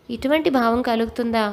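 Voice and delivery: ordinary delivery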